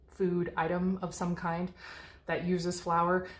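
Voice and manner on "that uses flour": ordinary delivery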